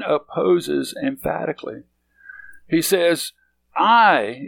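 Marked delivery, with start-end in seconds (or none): 3.8-4.4 "I" hit loud and drawn out; emphatic stress. Acted. Preacher showing the emphasis in the Greek, stressed "I". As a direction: lean hard on a long, loud "I". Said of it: "in the Greek it's emphasized twice".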